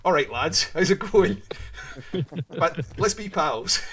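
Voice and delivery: loud, high mock-friendly hail to the lads; cheery crackheads. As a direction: play a chummy chancer rocking up uninvited. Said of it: "two fucking crackheads off the coast rocked up".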